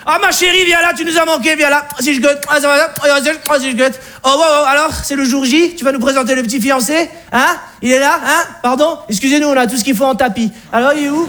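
high, hearty father-in-law voice; Alsatian father. Acted. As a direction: play the jovial Alsatian dad greeting his daughter. (père alsacien jovial, voix aiguë)

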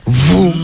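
drawn-out rising zoom noise; mouth-made acceleration sound; imitated speeding whoosh. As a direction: voice something shooting past. Imitates chicken speeding past.

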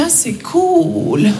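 very slow, drawn-out lukewarm reply; underwhelmed French friend. Acted. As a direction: stretch out a half-hearted congratulation. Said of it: "j'annonce à un pote français".

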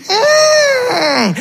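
put-on a sulking kid's pained squeal; whimper. Cri de frustration. (gémissement aigu d'enfant contrarié)

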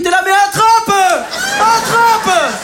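frantic urging. Retold or imitated. Stressed father shrieking at his son on the ride. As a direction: yell at the kid to grab it now.

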